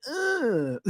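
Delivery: high, soft drawn-out "ugh"; Summer impression. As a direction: imitate someone reacting to everything with a little groan. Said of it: "the way she does where everything's like".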